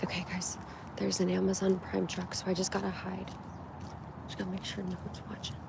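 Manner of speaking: very soft hushed whisper; whisper, hiding from the truck. Real, not acted.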